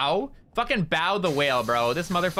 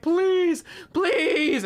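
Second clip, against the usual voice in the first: high, loud drawn-out begging; yelled plea; 4 dB louder than usual. Retold or imitated. Bao begs her to join the birthday collab, yelling. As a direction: imitate a friend yelling please. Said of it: "she was, like, yelling".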